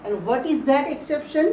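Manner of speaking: very slow, deliberate English for the class; teacher slowing right down on the English line; slow English emphasis. Shown as a demonstration. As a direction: spell out each word slowly for students. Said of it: "একটা ব্যতিক্রম আছে".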